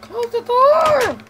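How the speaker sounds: high, loud, slow, drawn-out yell; panicked shout. Real, not acted. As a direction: scream for the door to shut. Mob at the door, panicking.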